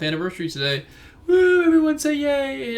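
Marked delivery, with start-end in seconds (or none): 1.3-2.8 high, loud whoop of a cheer. Real, not acted. To viewers about his six-month anniversary, a loud high cheer. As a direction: whoop it up and rally the crowd.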